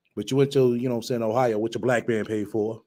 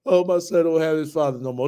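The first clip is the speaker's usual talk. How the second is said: a higher, louder mocking wail; mocking whiny lament; 5 semitones higher, 3 dB louder than usual. Mocking the woman in the clip, higher whiny lament. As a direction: mimic her crying over it with scorn. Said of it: "bust out because".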